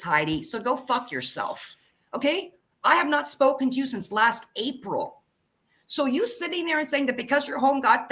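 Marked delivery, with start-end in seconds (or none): none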